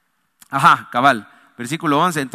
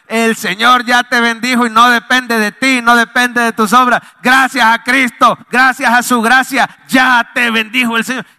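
louder, rising into a full shout on gracias; fervent preaching shout; 2 semitones higher, 5 dB louder than usual. Real, not acted. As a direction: proclaim it to the whole church, full force. Preacher exhorting the congregation. (grito de predicador, con fervor)

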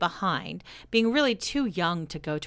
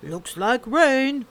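mock weather remark; 6 semitones higher, 7 dB louder, much slower than usual. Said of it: "walking up and down ... saying".

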